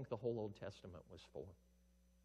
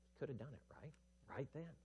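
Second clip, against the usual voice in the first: usual speaking voice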